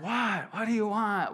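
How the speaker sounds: high, half-yelled call, loud but not full; retold yelling. Teen woken by grandfather, yelling back, mock yell. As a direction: call it out like being shouted awake. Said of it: "I heard him yelling and yelling".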